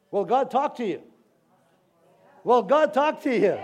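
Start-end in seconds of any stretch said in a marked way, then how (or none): 2.4-3.6 louder, higher call to the crowd; raised, rousing question. Real, not acted. Preacher presses the congregation again, loud and rousing.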